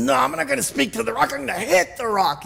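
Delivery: mock upset Moses. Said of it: "since Moses was upset".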